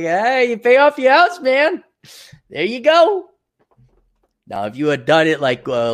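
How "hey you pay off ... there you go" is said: high, bright-toned mock cheer, louder; cheery congratulation. Speaker to debt-free friend, high mock cheer. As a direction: cheer him on like a proud buddy. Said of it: "I'm like".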